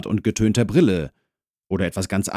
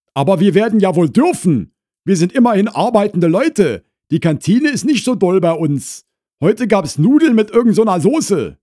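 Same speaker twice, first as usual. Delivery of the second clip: high, loud Berlin housewife; Frau Krause, indignant; 13 semitones higher, 8 dB louder than usual. Acted. Wife to visitor about dinner, indignant and loud. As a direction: stand up for yourself at high pitch.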